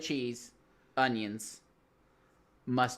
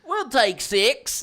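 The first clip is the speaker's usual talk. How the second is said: mock posh voice; 9 semitones higher, 5 dB louder, much slower than usual. Retelling the impression scene.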